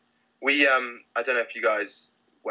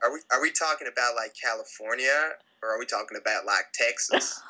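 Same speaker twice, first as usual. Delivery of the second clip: put-on American accent, Californian to Texan; a Brit having a go at American; 2 semitones higher than usual. Shown as a demonstration. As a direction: try your best American accent. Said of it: "Lay on me your best American accent".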